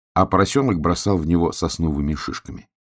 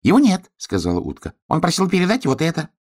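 little duck voice; 9 semitones higher, faster than usual. Acted.

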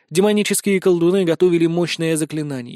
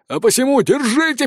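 rousing order; 8 semitones higher than usual. A narrator's reading. Elder orders the mob to seize him.

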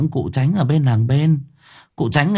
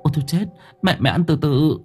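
higher, startled coaxing; alarmed plea; 6 semitones higher than usual. Acted. Mother alarmed at eating.

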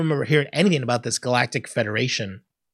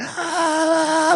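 long, very high mock scream; imitated sun scream. Replaying the screaming sun they loved, long mock scream. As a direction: sustain a high-pitched "aah" like a cartoon scream. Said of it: "it's just a screaming sun".

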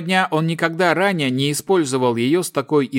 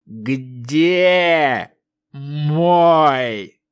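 slow, otherworldly, louder plea; eldritch horror; 4 dB louder, much slower than usual. Acted. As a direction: ask it like an unearthly spirit. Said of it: "потусторонним голосом произнесла тень".

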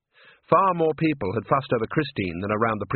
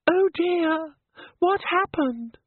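much higher, slow, a fragile waking sigh; swooning ingénue; much slower than usual. Acted. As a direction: play the fainting diva coming round for sympathy.

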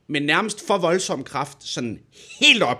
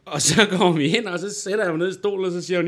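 normal talking voice

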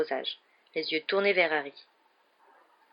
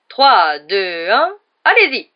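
cried countdown; 12 dB louder, much slower than usual. A narrator's reading. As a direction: count down, then shout the start. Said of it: "s'écria-t-il". (compte à rebours crié)

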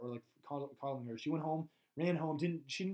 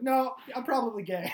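hammed-up confession; 8 semitones higher, 7 dB louder than usual. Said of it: "He's like".

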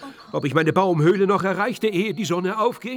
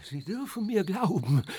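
ordinary delivery

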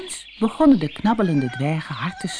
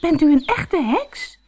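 much higher, louder astonished question; 11 semitones higher, 3 dB louder than usual. A narrator's reading. Doortje asks the old woman if she is a real witch. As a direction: ask it wide-eyed in disbelief.

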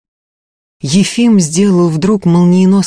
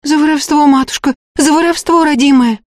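high, anxious peasant exclamations; fearful high-pitched confessing; frightened cries; 10 semitones higher than usual. A narrator's reading. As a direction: answer in a scared, placating wail. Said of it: "Послышались отдельные испуганные возгласы".

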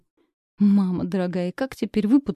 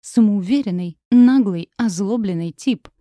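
angry insults; 7 dB louder than usual. Acted. She tells him what she thinks.

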